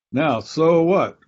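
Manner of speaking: preacher turning the point; rhetorical so what. Real, not acted. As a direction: challenge the room with a drawn-out so what. Rhetorical challenge to the audience.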